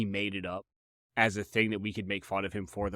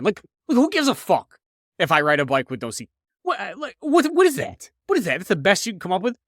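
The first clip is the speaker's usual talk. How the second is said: loud, much higher outraged rant; 9 semitones higher, 7 dB louder than usual. Real, not acted. Comedian ranting about a nickname, loud and outraged. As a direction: blow up at the stupid nickname.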